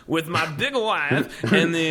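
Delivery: slow hammy Borat voice; mock Kazakh accent, drawn out. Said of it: "sounding like Borat".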